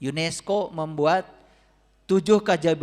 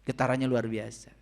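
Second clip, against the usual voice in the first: ordinary delivery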